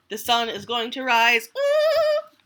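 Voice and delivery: high, squeaky little squirrel; squirrel piping up. Acted. Little Brown Squirrel pipes up to Bear, high and squeaky. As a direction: pipe up brightly like a tiny squirrel.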